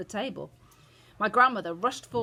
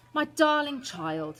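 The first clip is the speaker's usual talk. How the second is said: slow, higher-pitched marvelling; said slowly; 8 semitones higher, 4 dB louder, slower than usual. A narrator's reading. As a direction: draw it out fondly. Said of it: "she said slowly".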